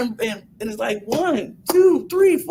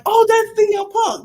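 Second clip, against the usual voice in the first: excited crowd shout; 12 semitones higher than usual. Retold or imitated. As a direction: shout it like a thrilled fan. Voicing the crowd that didn't react.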